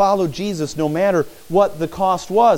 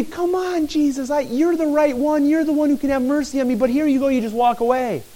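high-pitched plea at ordinary volume; pleading protest; 8 semitones higher than usual. Acted. Discouraged blind man as Jesus walks away, pleading protest. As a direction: plead as if being left behind.

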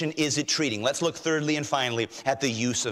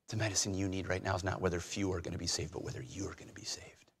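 urgent hush to whisper; 7 semitones lower, 10 dB quieter, faster than usual. Acted. Jesus voiced, dropping to whisper.

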